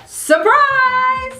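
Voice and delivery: high, bright "surprise!" reveal; spring it on the audience; playful announcement, pitched high; mock surprise. Shown as a demonstration. Punchline to the audience.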